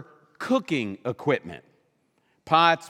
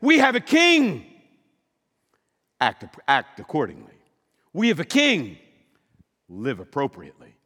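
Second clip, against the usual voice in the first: herald's proclamation; 4 semitones lower, 5 dB louder than usual. Retold or imitated. A herald announcing the new king to the people, loud commanding proclamation. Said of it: "Heralds would say".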